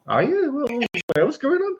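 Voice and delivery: mock surprised Luke; mock Luke reply. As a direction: play a baffled Luke, high and bright. Luke answering Vader, mock reply.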